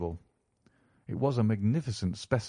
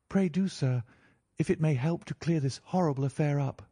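put-on higher-pitched young lady, eager to help; earnest niece in a raised pitch; willing niece; 7 semitones higher than usual. A narrator's reading. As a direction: politely urge the detective on. Mary consents to questioning.

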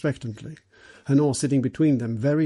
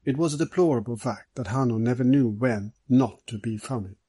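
usual speaking voice

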